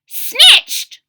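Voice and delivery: put-on very high, loud old-woman shriek; outraged ancient crone; cry of an ancient woman. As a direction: cry out in a seven hundred-year-old voice. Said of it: "she cried in a seven hundred-year-old voice".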